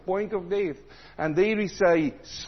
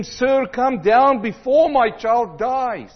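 desperate plea; 5 semitones higher, 6 dB louder, slower than usual. Acted. Desperate father begs Jesus.

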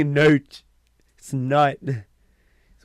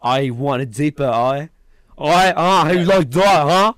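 put-on louder, pushy English accent; hammed-up louder aggressive English accent; aggressive Englishman; 5 semitones higher, 12 dB louder than usual. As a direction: bark it like a rough English bloke. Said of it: "a really aggressive Englishman".

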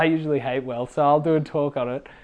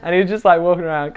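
ordinary delivery

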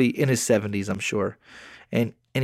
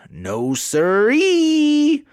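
much higher, louder, very slow; mock drawn-out taunt; 14 semitones higher, 5 dB louder, much slower than usual. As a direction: stretch it out high like a teasing country taunt. Mocking the scammer in retold reply.

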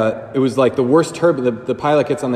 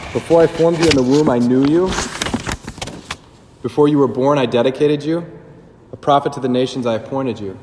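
louder proclaimed scripture in an altered voice; scripture reading; 3 dB louder than usual. Acted. Priest quotes Jeremiah to his listeners, louder and proclaimed. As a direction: proclaim the prophet's words with weight. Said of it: "Prophet Jeremiah".

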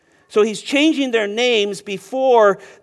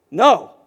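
a firm, louder "No."; emphatic no; 6 dB louder than usual. Acted. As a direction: put your whole weight on "No.". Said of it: "And it's emphatic in the text".

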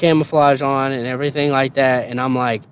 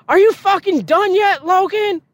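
drill sergeant yell. Retold or imitated. Drill sergeant yelling, very high.

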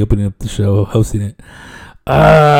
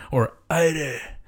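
ordinary talk, nothing marked

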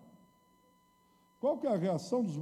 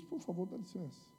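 gentle Jesus; 5 semitones higher, 6 dB quieter than usual. Acted. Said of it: "Jesus diz".

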